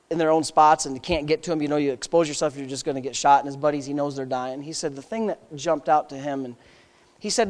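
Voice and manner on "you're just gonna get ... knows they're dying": ordinary talk, nothing marked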